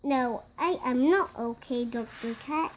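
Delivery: plain reading voice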